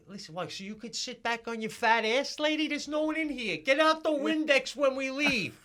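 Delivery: loud, high, furious rant at the saleslady; retold outburst, high and loud; angry rant. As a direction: shout it out, fed up with her. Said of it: "me and Jackie are like".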